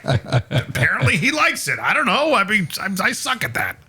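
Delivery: high and defensive; flustered protest. Retold or imitated. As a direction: squeak out a sheepish defence. Defending himself, flustered, in story.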